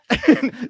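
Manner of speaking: a sudden high burst of laughter; high amazed laugh. Real, not acted. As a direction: laugh out of sheer disbelief. Amazed at the game ball.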